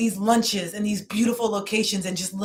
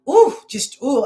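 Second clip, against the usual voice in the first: sudden high, loud ooh of feeling; a burst of emotion catching her; overwhelmed ooh; 6 semitones higher, 7 dB louder, slower than usual. Real, not acted. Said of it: "I'm feeling it so strong".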